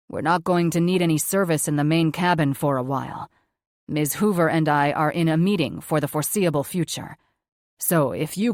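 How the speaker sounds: brisk boss giving orders over the intercom, a touch husky; businessman on the intercom. A narrator's reading. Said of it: "his voice hoarse and throaty".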